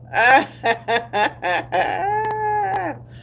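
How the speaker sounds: high laugh that trails downward; real helpless cackle. Real, not acted. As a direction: break into a long, high laugh. Amused by country wording.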